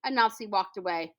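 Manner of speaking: ordinary delivery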